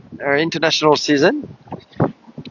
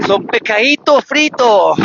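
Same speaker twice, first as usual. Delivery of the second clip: Andalusian accent; 3 semitones higher than usual. Shown as a demonstration. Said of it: "speak with the Andalusian accent".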